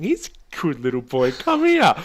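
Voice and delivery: sweet-talking a rattlesnake like a dog; baby talk to a pet. Retold or imitated. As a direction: coo at the snake like a puppy. Cooing at a rattlesnake.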